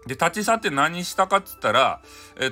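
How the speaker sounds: usual speaking voice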